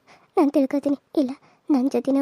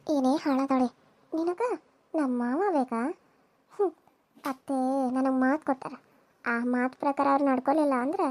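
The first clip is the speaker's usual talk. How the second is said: hushed villain threat; scheming villainess; 2 semitones lower, 6 dB quieter than usual. Acted. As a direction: murmur the poison plan like a schemer. Villain plots poisoning.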